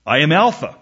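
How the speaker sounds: loud, brisk, ringing Bible verse; scripture proclamation. Acted. Preacher reads God's words from Revelation, proclaiming. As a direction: declaim it as God's own announcement.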